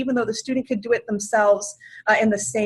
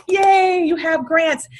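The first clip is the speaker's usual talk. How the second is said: bright, louder cheer on "Yay"; excited yay; 5 semitones higher, 5 dB louder, much slower than usual. Retold or imitated. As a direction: cheer the student's good news. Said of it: "that's where I like".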